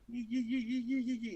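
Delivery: yee yee chanted high and soft; a high chanting yee, mocking the players. Players dancing round the ball, chanting yee high and soft. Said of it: "They went".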